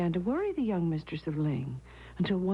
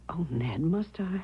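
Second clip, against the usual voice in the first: whispering; 4 semitones lower, slower than usual. Acted. Hushed talk of shocking secret.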